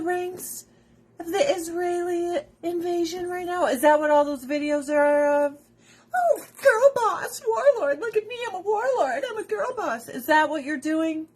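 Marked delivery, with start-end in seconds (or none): 6.1-10.1 much higher, louder, mocking girl-boss voice; mocking little voice. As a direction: squeak it out like a smug girl boss. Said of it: "a chick with a little voice".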